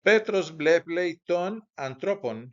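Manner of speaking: Greek read out slowly, "Petros" stressed. Shown as a demonstration. Teacher reads the Greek sentence slowly to the class. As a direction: read "Petros bleplei ton antropon" slowly and clearly, as a model. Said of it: "teacher reads Greek sentence aloud".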